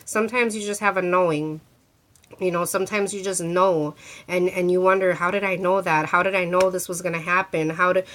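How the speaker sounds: ordinary talk, nothing marked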